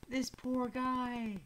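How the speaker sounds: excited pity. Real, not acted. Streamer pities the speared soldier, slow excited. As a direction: stretch it out in amused pity. Amused pity after the kill.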